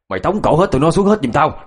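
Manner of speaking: high, forceful order; seething anger. A narrator's reading. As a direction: order it out in angry exasperation. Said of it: "mặt hầm hầm nói với phụ xe".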